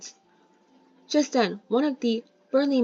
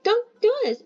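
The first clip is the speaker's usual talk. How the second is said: sudden high-pitched plea, much higher; girl's high cry; 13 semitones higher, 4 dB louder than usual. Acted. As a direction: cry out high like a frightened girl. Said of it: "she exclaimed".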